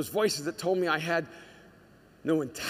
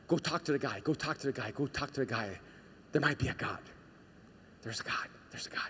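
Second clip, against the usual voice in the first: soft, low murmur fading to a whisper; insistent inner voice; 4 semitones lower, 6 dB quieter, faster than usual. Acted. Inner voice nudging an atheist toward God, hushed and repetitive. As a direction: repeat it like a nagging thought, hushed. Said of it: "maybe that voice said".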